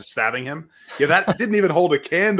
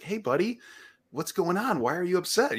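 put-on higher, softened voice for a child; gentle concerned dad; 5 semitones higher, 3 dB quieter than usual. Dad retelling how he asked his upset son, gentle raised pitch. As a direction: check in softly, pitched up.

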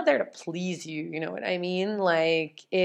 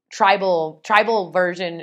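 ordinary talk, nothing marked

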